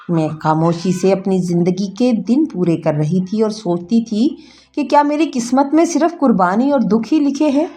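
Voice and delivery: ordinary delivery, nothing marked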